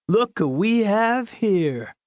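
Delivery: high, drawn-out schoolboy taunt; slow, high teasing call; mocking greeting. A narrator's reading. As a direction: stretch it out like a hammed-up discovery. Teasing friend greets eye-patched boy.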